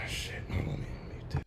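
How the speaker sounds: real aside, whispered off-mic; sighing whisper to mute. Real, not acted. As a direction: breathe it low so the stream won't hear. Muting mic mid-recording.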